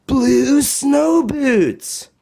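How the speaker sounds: high, slow wonder on the reveal; magical reveal. A narrator's reading. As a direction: draw it out like a magic trick.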